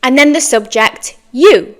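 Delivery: ordinary talk, nothing marked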